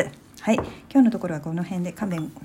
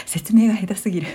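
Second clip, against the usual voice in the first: laughing; 4 semitones higher, 3 dB louder than usual. Real, not acted. Laughing at own bad explanation.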